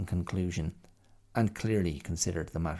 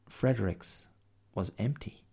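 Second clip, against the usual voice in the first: ordinary delivery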